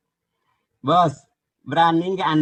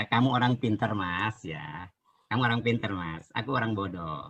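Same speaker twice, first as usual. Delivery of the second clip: much softer, mock-humble sarcasm; sarcastic humility; 6 semitones lower, 7 dB quieter than usual. Real, not acted. Conceding to a rival debater with soft mock humility. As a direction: let it drop to a sly murmur of false modesty.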